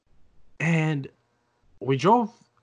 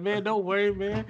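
lightly voiced friend, pitched up; dismissive friend impression; 8 semitones higher than usual. Friend waving away the danger, mock-dismissive. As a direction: brush the worry off in a higher imitated voice.

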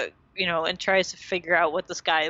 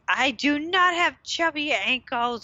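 high, slow, offended insistence; slow, high, mock-offended denial; indignant protest; 8 semitones higher than usual. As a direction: imitate Rachel insulted, word by word. Rachel retold reading the list.

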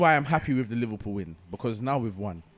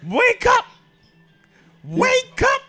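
preacher shout; 13 dB louder, much slower than usual. Retold or imitated.

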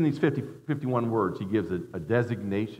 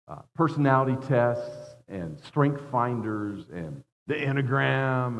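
ordinary delivery

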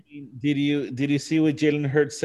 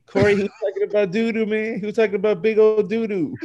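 higher, fast, can't believe he said it; incredulous laughing rant; 7 semitones higher, faster than usual. Real, not acted. To Corey about Jalen's doo-doo talk, incredulous. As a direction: rattle it off high and amazed.

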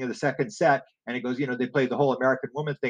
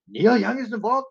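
higher and louder disbelieving question; astonished; 9 semitones higher, 5 dB louder than usual. Retold or imitated. Teller asking [name] again about [name], astonished. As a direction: lift it up in amazement.

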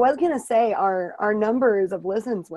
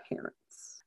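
softer, whispered ending; 19 dB quieter than usual. Real, not acted. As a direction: drop to a whisper on the last words. Whispered punchline.

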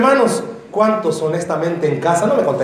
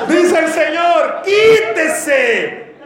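preaching shout; 10 semitones higher, much slower than usual. Real, not acted. Preacher proclaims the Lord's command to the congregation. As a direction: thunder out Quítese like a divine command.

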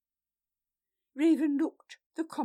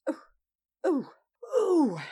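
higher, louder wail of woe; lamenting; 6 semitones higher, 9 dB louder, slower than usual. A narrator's reading. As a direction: wail in self-pity. Eurolf's theatrical lament.

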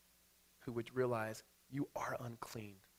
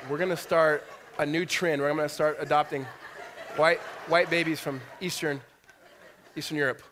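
louder, words broken by laughter; preacher laughing at his adoption joke; laughing while talking; 4 semitones higher, 10 dB louder than usual. Real, not acted. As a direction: push the joke out through laughs.

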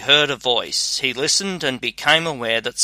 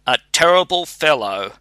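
usual speaking voice

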